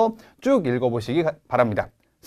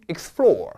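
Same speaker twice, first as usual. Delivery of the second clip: Explore said with weight; stressed verb. Shown as a demonstration. Teacher models the stressed main verb. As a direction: punch Explore firmly. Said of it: "본동사 세게 읽고".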